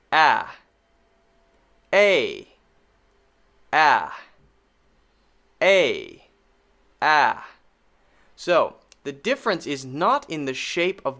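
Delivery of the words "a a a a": a said as the short vowel in fan. Shown as a demonstration. Teacher contrasting short a with long A. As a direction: model the short a. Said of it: "the long A and the short A".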